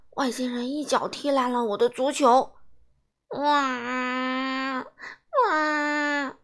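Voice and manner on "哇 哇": put-on childish wailing sob; wailing cry. Boy whose football was kicked apart, wailing. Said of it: "我放声大哭".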